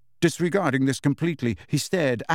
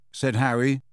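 usual speaking voice

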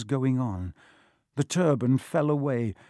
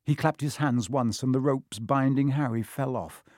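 ordinary delivery

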